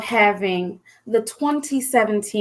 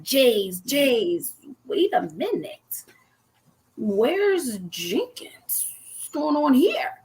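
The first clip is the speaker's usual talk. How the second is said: puzzled searching; 6 dB quieter, slower than usual. Retold or imitated. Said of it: "and I'm like".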